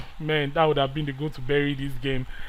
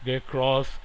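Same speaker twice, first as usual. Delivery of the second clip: ordinary talk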